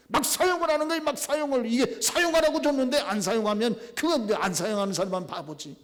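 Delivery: high, loud, fired-up repeat; preaching shout. Shown as a demonstration. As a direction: shout it out to the congregation. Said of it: "preacher repeats louder". (막 사용하라고 외치듯 높고 크게)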